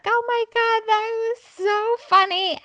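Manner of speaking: squealing mock delight; gushing fake laugh. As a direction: gush over the joke like it's hilarious. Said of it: "I was like".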